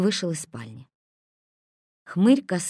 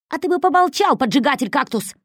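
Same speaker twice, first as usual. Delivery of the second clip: angry retort; 8 semitones higher, 7 dB louder than usual. A narrator's reading.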